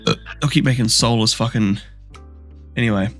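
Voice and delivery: ordinary talk, nothing marked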